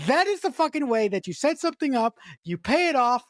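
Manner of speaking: high, emphatic enthusiasm; excited raving. Real, not acted. Film fan praising the payoff, excited raving. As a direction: rave about it, hitting the swear words.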